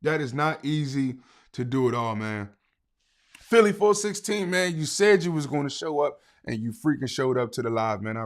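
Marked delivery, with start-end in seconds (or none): none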